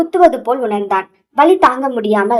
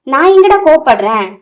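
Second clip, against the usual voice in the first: louder, higher, acted indignation; indignant denial; 4 semitones higher, 5 dB louder than usual. Karthik denies to his friend that he's angry, indignant. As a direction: protest it, raised in pitch and volume.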